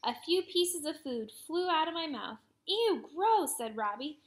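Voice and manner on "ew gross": grossed out, high and loud; disgusted. A narrator's reading. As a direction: recoil in disgust. Reacting to spat food.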